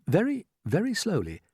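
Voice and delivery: ordinary delivery, nothing marked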